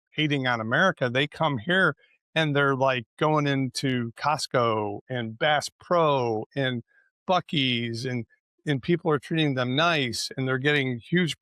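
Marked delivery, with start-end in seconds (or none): none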